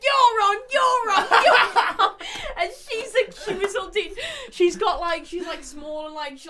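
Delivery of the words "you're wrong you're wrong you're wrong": high, loud laughing yell; laughing protest. Retold or imitated. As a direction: shout it out while laughing. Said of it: "We were like".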